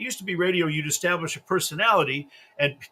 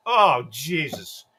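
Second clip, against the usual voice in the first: ordinary talk, nothing marked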